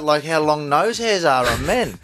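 ordinary delivery

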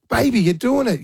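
retold cheering. Proud dad cheering daughter.

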